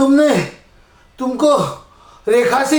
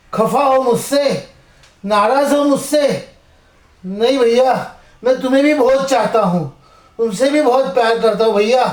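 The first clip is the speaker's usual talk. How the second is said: ordinary talk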